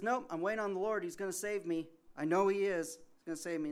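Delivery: man on the second floor brushing off the boat, loud and sure; stubborn certainty. Retold or imitated. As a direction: insist on it, almost cheerfully defiant. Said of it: "The guy says".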